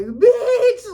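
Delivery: high, loud, laughing shriek of shock; whole theater shocked. Retold or imitated. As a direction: shriek it like a stunned audience. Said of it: "The whole theater was like".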